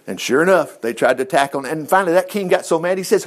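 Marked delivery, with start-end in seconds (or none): none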